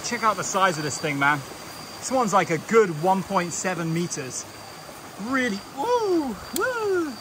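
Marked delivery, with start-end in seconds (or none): 5.3-7.1 high, slow whoops of delight; excited woo. Real, not acted. Marvelling at a huge snake, high excited whoops. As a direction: cheer at the size of the snake.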